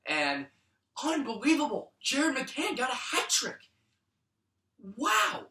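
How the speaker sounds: high, amazed exclamations; overjoyed amazement at the hat trick; thrilled disbelief. Real, not acted. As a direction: gasp it out in disbelief.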